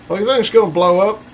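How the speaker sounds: quick, high, mocking retort; scoffing challenge. Friend mocking the worry that the cord will blow, fast scoffing retort. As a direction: toss the doubt back, daring him.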